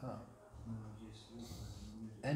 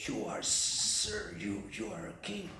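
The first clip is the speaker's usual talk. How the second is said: hissing snake; 4 semitones lower, 5 dB quieter than usual. Acted. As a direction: hiss it out like a snake. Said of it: "hissing sound".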